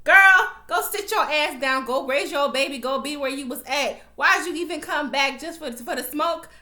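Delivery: fed-up telling off. Real, not acted. Vlogger scolding Laura Castillo, loud and fed up. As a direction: tell her off like she should know better.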